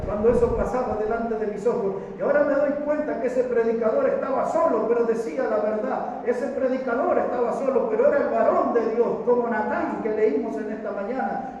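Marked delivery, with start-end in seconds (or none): 2.2-11.6 louder, higher impassioned preaching; preaching at full force. Acted. Preacher to his congregation about the lone prophet, impassioned. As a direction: drive the point home to the church.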